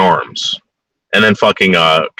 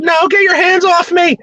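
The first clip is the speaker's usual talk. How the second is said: high pleading cry, much higher; imitating the captive soldier. Retelling the soldier begging her captors, high pleading. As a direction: play her begging them to stop. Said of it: "She said".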